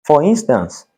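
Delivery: ordinary delivery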